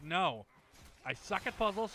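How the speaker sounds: ordinary talk, nothing marked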